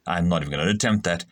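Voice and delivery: ordinary delivery, nothing marked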